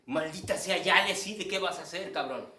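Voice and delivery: loud, high exasperated demand; urgencia exasperada. Acted. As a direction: act out a spectator who can't bear the wait. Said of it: "se convierte en una urgencia de". (exigencia urgente y exasperada, fuerte y aguda)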